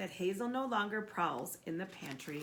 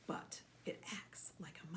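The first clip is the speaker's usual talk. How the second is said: whispered; 3 semitones lower, 10 dB quieter than usual. A narrator's reading. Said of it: "said Paul".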